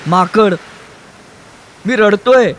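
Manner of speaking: high, loud, tearful outburst; trembling shout. A narrator's reading. Trembling man to the woman he found, tearful shouting. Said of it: "तो कापऱ्या आवाजात ओरडला".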